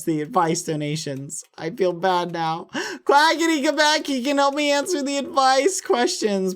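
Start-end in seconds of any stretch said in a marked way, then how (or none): 3.0-6.5 high-pitched whiny begging; high, melodramatic plea; pleading call. Pretend. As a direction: coax a friend back in a high voice. Begging Quackity to return.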